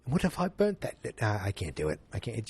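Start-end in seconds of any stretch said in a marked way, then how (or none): 0.0-1.2 hammed-up British actor, rising in pitch; Michael Caine. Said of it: "Let me do a better Michael Caine".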